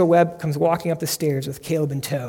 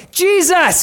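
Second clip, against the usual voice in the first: high, loud cry for Jesus; shouting; 12 semitones higher, 6 dB louder than usual. Acted. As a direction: call out loudly over a crowd, pitched high. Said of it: "he's just shouting".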